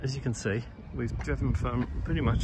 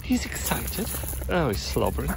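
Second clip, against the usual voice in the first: excited dog talk; 2 semitones higher, 4 dB louder than usual. Real, not acted. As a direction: keep gushing over the dog. Still cooing at the dog.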